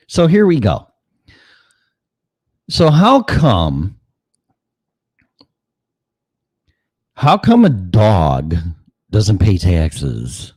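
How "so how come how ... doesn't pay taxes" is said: drawn-out, rising question, dragging every word; milk the setup before the joke; slow punchline setup. Acted. Comedian teasing the audience before the punchline, slowly.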